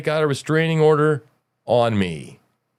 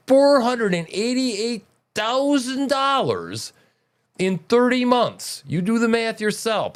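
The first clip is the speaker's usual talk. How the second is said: indignant; 8 semitones higher than usual. Real, not acted. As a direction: raise the voice in disbelief. Indignant rant, pitch raised.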